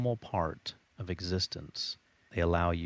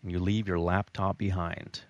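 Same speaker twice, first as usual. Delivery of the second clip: ordinary talk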